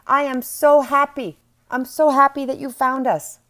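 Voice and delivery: loud, high, elated welcome; overjoyed angels. Acted. Angels hugging and kissing the one who called them, loudly overjoyed. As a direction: burst out with joy, voice lifted. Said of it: "That's your angels".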